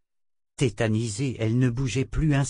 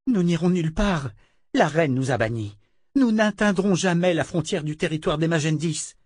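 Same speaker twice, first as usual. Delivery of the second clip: high-pitched, indignant protest; angry refusal; 7 semitones higher than usual. A narrator's reading. Verna objecting that the queen banished them, indignant. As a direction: protest heatedly, pitch pushed up.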